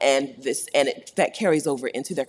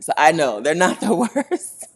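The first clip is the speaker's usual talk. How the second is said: breaking into real laughter; amused, laughing mid-sentence; 2 semitones higher, 5 dB louder than usual. Real, not acted. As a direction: let a laugh break through the words. Cracks up at own joke.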